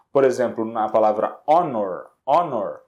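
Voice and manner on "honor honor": "honor" starting straight on the O, no H sound; low-pitched "honor" with the H left silent. Shown as a demonstration. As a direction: drop the H and open "honor" on its vowel. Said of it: "Se o H não fosse pronunciado".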